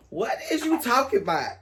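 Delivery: high, laughing incredulous question; laughing disbelief. Retold or imitated.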